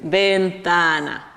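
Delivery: Ventana stretched out slowly; slow teacher reveal of "Ventana"; slow answer reveal. Shown as a demonstration. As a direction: draw "Ventana" out for the class. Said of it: "se abre para mirar para afuera".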